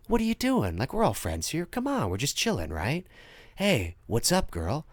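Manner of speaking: low, soft, laid-back phone voice; chill creepy caller. Retold or imitated. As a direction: act all friendly and easy. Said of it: "the way he goes out".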